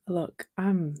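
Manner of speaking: ordinary delivery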